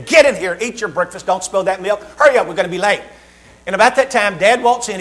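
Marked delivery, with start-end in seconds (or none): none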